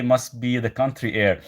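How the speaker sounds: normal talking voice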